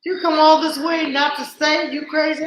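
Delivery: playing Bronwyn, amazed he won't stay; incredulous friend. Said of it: "Bronwyn was like".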